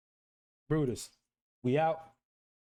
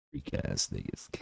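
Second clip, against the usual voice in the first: unvoiced, much lower, softer; muttered aside; 8 dB quieter than usual. Real, not acted. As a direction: mutter it under your breath. Muttered sign-off.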